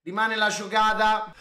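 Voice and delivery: ordinary delivery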